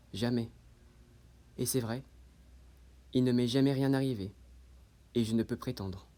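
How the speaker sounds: low, soft and resigned, with long pauses; hushed, low-pitched confession; subdued resignation. Acted. Monologue closes in resigned admission.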